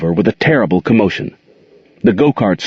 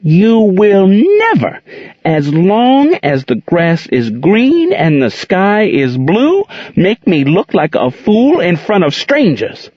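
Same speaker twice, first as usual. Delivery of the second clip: rising slow fury; 11 semitones higher, slower than usual. A narrator's reading. Aunt Queen scolding Gerald for shaming her, high slow fury. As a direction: build the anger, every word weighed. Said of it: "slowly but explosively".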